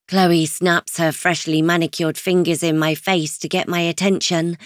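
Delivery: ordinary delivery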